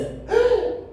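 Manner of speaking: high theatrical gasp of horror; teacher fakes shock at a spelling error; mock gasp. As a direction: gasp as if the pupil made a terrible mistake.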